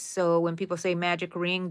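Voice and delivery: ordinary talk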